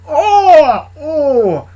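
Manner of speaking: high, loud cry of pain; hurt man's loud oh; pained groan. Acted. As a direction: howl after the kick. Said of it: "He went".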